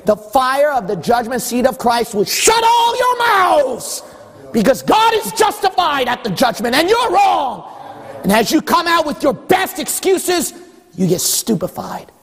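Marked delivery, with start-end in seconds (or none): 0.1-10.5 fiery preaching. Acted. Preaching judgment at full force.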